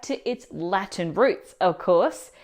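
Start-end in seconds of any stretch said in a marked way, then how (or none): none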